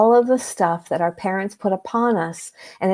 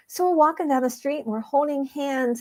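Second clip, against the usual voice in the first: normal talking voice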